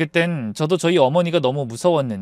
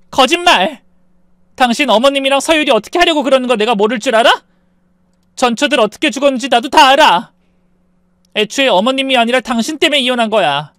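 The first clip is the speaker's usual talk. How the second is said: higher, louder, heated outburst; furious accusing wife; 7 semitones higher, 7 dB louder than usual. A narrator's reading. Wife accusing ex-husband over his former wives, furious. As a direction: call out his lie with force.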